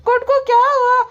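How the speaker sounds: high, loud question; high loud mock concern; squeaky wife. Voicing the brother's wife.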